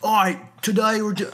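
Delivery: high, loud, hammed-up Australian accent. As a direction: fake Australian host voice, cut short. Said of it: "do an Australian accent".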